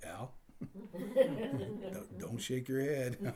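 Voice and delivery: quiet, giggling scold; wife, laughing. Retold or imitated. Wife telling husband to stop shaking his head, laughing quietly. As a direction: scold him softly through laughter. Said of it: "she's like".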